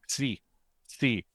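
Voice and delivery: "C" pronounced as psi, set apart; letter sound demo. Shown as a demonstration. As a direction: say "C" as the Greek letter psi, with a hissed onset. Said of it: "It actually makes that s-, sound".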